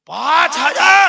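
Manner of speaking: পাঁচ হাজার shouted high and slow; the imam boasting of his village, shouted; hot, proud shout. Retold or imitated. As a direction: bellow পাঁচ হাজার, slowly and hotly. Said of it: "গরম হয়ে বলছে".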